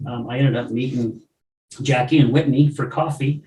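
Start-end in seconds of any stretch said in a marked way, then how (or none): none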